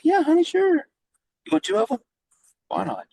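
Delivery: high, loud, cheery lady voice; sweet truck lady. Retold or imitated. Food-truck lady sweetly offers him two burgers, high and cheery.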